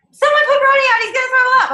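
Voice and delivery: high, loud holler to the household; acted frantic mom shout; mom yelling. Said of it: "when you're talking to a mom".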